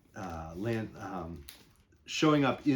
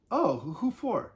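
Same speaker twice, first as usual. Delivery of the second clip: curious woman at a party; 11 semitones higher than usual. Acted. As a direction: draw it out like a nosy partygoer.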